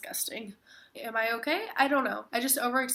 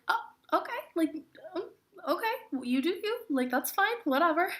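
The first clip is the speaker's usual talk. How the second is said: much higher, put-off trainee voice; awkward coworker; 8 semitones higher than usual. Retold or imitated. Trainee at work reacting to her outburst, high and awkward. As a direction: mimic an awkward girl brushing it off, pitched way up. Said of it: "she was like".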